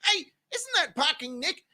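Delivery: ordinary talk, nothing marked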